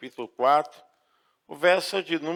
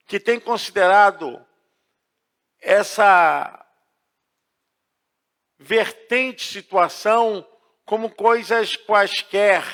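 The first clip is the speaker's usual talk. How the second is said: loud, raised preacher's delivery with stretched phrase endings; forceful preaching; 6 semitones higher, 8 dB louder than usual. Real, not acted. Pastor urging believers to trust the Bible, preaching forcefully. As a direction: drive each phrase out to the whole congregation.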